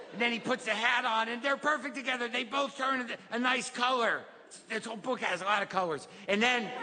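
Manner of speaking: lightly put-on kid storyteller, lifting with wonder; child telling a happy ending. Kid recounting the happy ending, childlike excited storytelling. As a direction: run the clauses together like an eager child.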